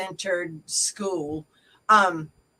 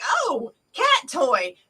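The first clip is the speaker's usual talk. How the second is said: slow, raised-pitch cat spotting a toy; drawn-out excited cat voice; 6 semitones higher, much slower than usual. Retold or imitated. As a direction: play a cat's drawn-out delight. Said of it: "my cat would be like".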